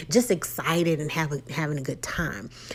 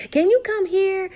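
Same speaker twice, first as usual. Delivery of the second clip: calling out; 11 semitones higher than usual. Retold or imitated. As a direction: call him from another room. Wife calling out to husband.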